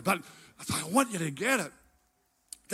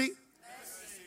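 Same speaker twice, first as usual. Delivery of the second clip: ordinary talk, nothing marked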